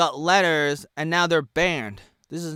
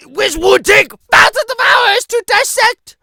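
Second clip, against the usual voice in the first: shouting, much higher and louder; loud rant; 13 dB louder than usual. Real, not acted. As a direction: bellow it in frustration. Ranting about his documentation.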